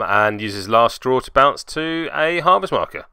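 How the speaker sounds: ordinary delivery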